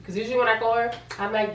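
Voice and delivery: usual speaking voice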